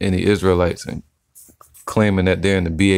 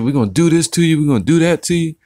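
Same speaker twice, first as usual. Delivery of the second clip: higher-pitched imitation of a hothead threatening; mock tough talk; 9 semitones higher, faster than usual. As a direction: mimic a loudmouth making threats. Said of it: "talking crazy and shit".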